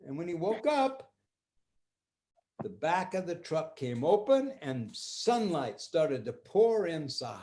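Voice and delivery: usual speaking voice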